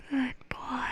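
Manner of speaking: high cooing praise for a pet; pet talk. Real, not acted. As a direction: coo it to the dog.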